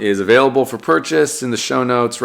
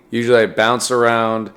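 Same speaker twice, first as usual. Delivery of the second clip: ordinary talk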